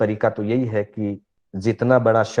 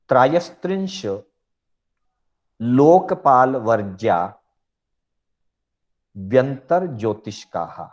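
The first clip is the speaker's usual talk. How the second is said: piece-by-piece sutra recitation. Shown as a demonstration. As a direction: recite each piece, then pause. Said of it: "हम इसको टुकड़े टुकड़े में बोल सकते हैं". (सूत्र का टुकड़े टुकड़े में उच्चारण)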